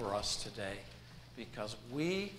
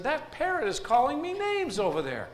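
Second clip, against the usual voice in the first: upset complaint; 12 semitones higher, 5 dB louder than usual. Acted. Customer complains to manager.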